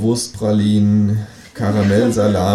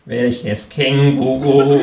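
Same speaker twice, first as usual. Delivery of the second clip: slow, strained high question; tense; 5 semitones higher, 4 dB louder, much slower than usual. A narrator's reading. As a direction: ask it nervously, playing dumb. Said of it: "fragt der Psychiater angespannt".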